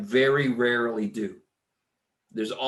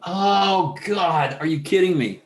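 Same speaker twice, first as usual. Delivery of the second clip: louder, higher burst of frustration; exasperated outburst; 6 semitones higher, 5 dB louder than usual. Real, not acted. Scene: teacher discovering his mistake again, exasperated outburst. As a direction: throw up your hands at the error.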